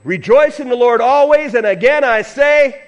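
loud, high call to the congregation; rallying shout. Real, not acted. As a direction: shout it to cue their answer. Cueing congregation's loud response.

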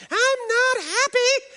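very high, slow wailing "I'm not happy"; mock toddler scream. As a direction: wail it like a screaming child. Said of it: "we would hear it screaming through the walls".

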